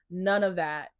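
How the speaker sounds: ordinary delivery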